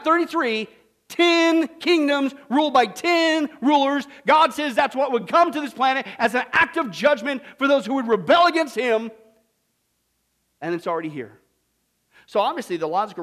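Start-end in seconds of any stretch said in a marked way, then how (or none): none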